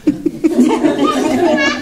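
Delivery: bursts into a warm laugh. Real, not acted. Storyteller laughing at her own tale, laughing.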